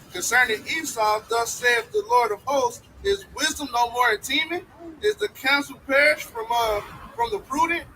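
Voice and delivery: slow, rising, emphatic reading; scripture reading. Acted. Brother reading Jeremiah to the group, slow and building. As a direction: build the verse slowly to the last question.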